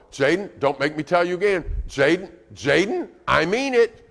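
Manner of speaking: parent losing patience, pitch climbing; raising voice. Acted. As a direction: escalate from firm to exasperated. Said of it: "you keep raising your voice".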